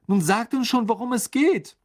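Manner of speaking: irritated. A narrator's reading. Ron demanding the secret from his mother, irritated. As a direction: press them crossly to spill it. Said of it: "sagte Ron gereizt".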